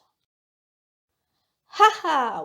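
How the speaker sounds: drawn-out, slightly proud "哈哈"; proud laugh-word. Shown as a demonstration. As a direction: stretch out a mildly triumphant "哈哈". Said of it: "express pride or surprise".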